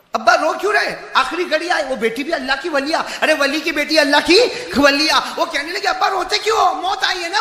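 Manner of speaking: high, loud preacher's retelling of her plea; acted anguished questioning, building to a shout; impassioned pleading daughter. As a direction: cry out her questions to her father. Preacher voices daughter questioning dying father.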